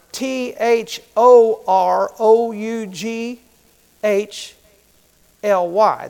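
slow, letter by letter spelling. Shown as a demonstration. Preacher spelling thoroughly for the congregation, slowly. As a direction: spell each letter apart like dictating. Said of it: "You spell thoroughly".

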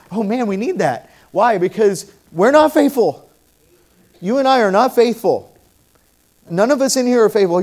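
loud, high preacher's cry of confession; full-force preaching. Acted. To the church, confessing nobody is faithful, loud preaching. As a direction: drive it home to the congregation.

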